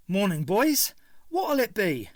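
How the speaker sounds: Cockney stallholder greeting the fascist youths; forced cheery Cockney. A narrator's reading. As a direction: greet the thugs with put-on cheer. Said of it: "His Cockney accent".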